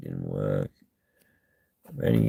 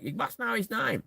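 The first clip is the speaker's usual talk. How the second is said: mimicking cops; 12 semitones higher, 10 dB quieter than usual. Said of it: "And the coppers are like".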